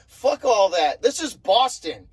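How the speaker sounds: ordinary delivery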